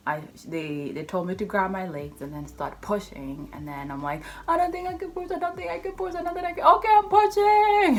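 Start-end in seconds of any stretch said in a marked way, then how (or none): 4.5-7.9 high, strained, pleading voice; whimpering panic. Retold or imitated. Mother in labor to nurses, high frightened whimper turning to a strained push. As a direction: plead in a thin high voice, then force out the last line loud.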